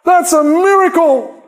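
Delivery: David exclaims at the heavens in awe; awed David. Acted. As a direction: shout it out, struck by the sky. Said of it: "David looks up and says".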